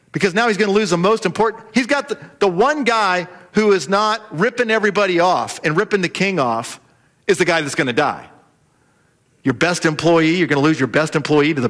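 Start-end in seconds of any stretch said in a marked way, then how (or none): none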